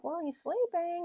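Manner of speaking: high-pitched imitation of a dismissive nurse. Wife retelling the night nurse's reply, mock-dismissive. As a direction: retell the brush-off, pitched well up. Retold nurse brushing off concern.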